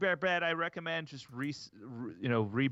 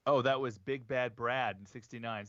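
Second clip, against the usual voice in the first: ordinary delivery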